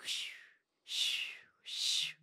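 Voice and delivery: whispered shushing. Retold or imitated. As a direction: hush them in a whisper. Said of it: "And they're like".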